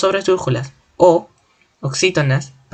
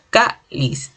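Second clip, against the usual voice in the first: "Cáliz" split, with weight on "Cá"; two-beat teaching "Cáliz", first syllable strongest; stress on first syllable. Shown as a demonstration. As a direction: stress "Cá", pause, then a lighter "liz". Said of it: "mayor fuerza de voz en K".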